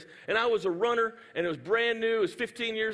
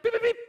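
very high rapid machine beeps; treadmill beeps; 13 semitones higher, 4 dB louder, much faster than usual. Retold or imitated. Preacher mimicking the girl beeping up her treadmill, high-pitched beeping. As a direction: chirp like treadmill buttons. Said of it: "she beeps up".

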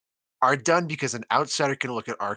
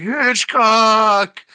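loud, high, drawn-out holler; mock mountaintop shout; 10 semitones higher, 11 dB louder than usual. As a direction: bellow it as if from a mountaintop. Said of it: "just shouting, like".

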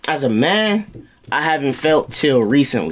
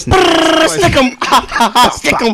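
loud, high rap-video yell; high loud ad-lib shout; hype shout; 10 semitones higher, 4 dB louder, much slower than usual. Pretend.

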